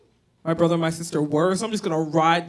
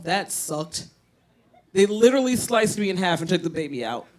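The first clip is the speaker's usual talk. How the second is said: ordinary talk, nothing marked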